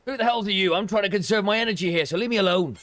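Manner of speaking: high, loud grumpy snarl; irritable refugee. Acted. Streamer voicing a cranky NPC, high and loud. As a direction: shoo the pest away, cranky and loud.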